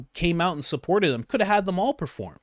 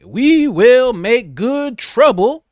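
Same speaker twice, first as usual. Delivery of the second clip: slow, louder, higher orator voice; lady imitating Malcolm X, slow and loud; mock Malcolm X; 7 semitones higher, 9 dB louder, much slower than usual. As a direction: preach it like a sixties activist. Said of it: "talk like Malcolm X".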